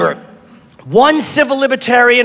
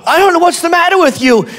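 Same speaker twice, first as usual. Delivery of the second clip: a girlfriend's exasperated complaint, voiced higher; higher mock girlfriend; 7 semitones higher, faster than usual. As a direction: pitch it up like a fed-up girlfriend. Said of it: "she goes".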